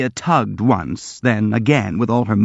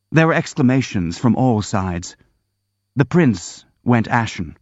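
ordinary delivery

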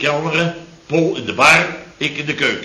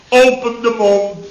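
put-on drunken slur; 6 semitones higher, slower than usual. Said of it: "te lallen van".

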